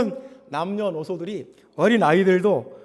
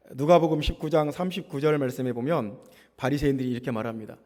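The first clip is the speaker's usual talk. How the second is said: ordinary talk, nothing marked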